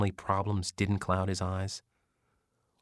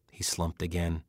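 ordinary delivery

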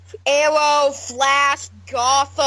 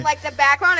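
usual speaking voice

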